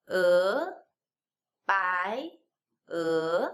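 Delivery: "e" and "bai" each gliding up from low; rising second tone. Shown as a demonstration. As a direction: swoop every "e" and "bai" upward. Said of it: "second tone".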